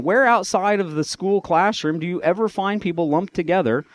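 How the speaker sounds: usual speaking voice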